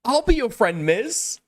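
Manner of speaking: high, sugary consoling voice, hammed up; teasing impression of a doting friend; mock sympathetic friend. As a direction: coo it like a fake-sweet buddy. Mocking J-Stalk comforting Mizkif.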